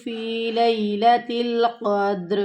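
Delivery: slow, drawn-out tajweed chanting; Quran recitation. Shown as a demonstration. Teacher chanting the verse slowly for her students. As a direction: recite each word long and measured.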